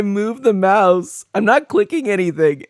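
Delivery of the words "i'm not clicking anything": normal talking voice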